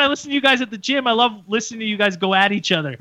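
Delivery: high excited fan voice, rattled off fast; gushing starstruck fan, way up high and quick; imitating the fan. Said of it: "He's like".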